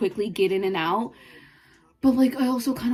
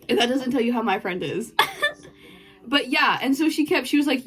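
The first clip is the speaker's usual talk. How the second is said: normal talking voice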